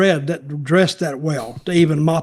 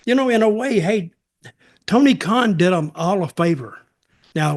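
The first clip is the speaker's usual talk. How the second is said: ordinary talk, nothing marked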